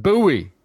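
[name] said as BOO-ee; disputed pronunciation. Shown as a demonstration. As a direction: stress the odd "BOO-ee" form of [name]. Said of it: "is just never pronounced [name]".

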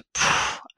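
sharp puff of breath, no voice; whooshing exhale. Retold or imitated. Woman retelling the moment the message hit her, whooshing exhale. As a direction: blow out air in a short whoosh. Imitates the jolt she felt.

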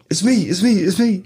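high, eager mock passenger voice; insistent high-pitched retold babble; imitated wasted passenger. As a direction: pitch it up like an overexcited drunk.

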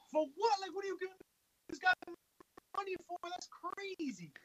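incredulous. Real, not acted. Incredulous rant.

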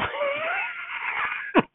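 sudden high shriek of laughter; startled shriek laugh. Real, not acted. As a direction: yelp then laugh at the thunder. Loud burst at lightning.

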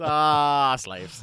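put-on loud, high Chicago accent bark. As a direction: blurt it like a Chicago superfan. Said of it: "He's just got this high Chicago accent".

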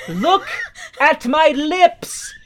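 slow, loud, exasperated shout spaced out word by word; exasperated yell. Retold or imitated. Fed up friend yelling at Guido, slow and loud. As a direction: yell it slow like they're too dense to get it.

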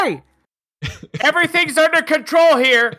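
high-pitched mock yell, no real volume; mock scream. Cop calling up to the screaming girl, mock yell. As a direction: imitate a cop hollering, half-hearted. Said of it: "he screams it at her".

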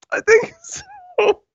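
high, slow, laughing through it; squeaking through the giggles; laughing squeal. Real, not acted. Laughing after hearing herself.

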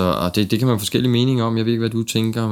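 ordinary delivery

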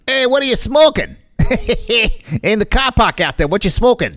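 high, mocking Boston skeptic; Boston accent. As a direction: tease them in a thick Boston accent.

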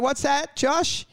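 high, cooing praise. Pretend. Host coos at co-host in mock compassion. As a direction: coo at him like a good boy. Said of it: "I'm just like".

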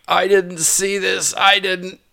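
high, innocent protest; feigned surprise. Retold or imitated. Caught owner protests to the police, high and innocent. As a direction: play dumb with a high startled voice. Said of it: "and I am like".

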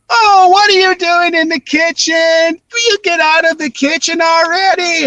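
high, sustained, drawn-out scolding singsong; mom's put-on voice. Mom shooing someone out of the kitchen, high drawn-out mock scolding. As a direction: imitate mom in a slow, high, stretched-out wail. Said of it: "hearing things like".

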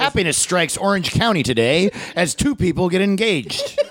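high, laughing mock newsreader; bright fluff-story intro; cheery news voice.

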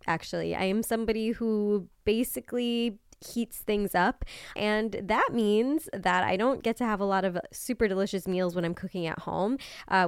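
soft, even sponsor read. Acted. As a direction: read the ad smoothly.

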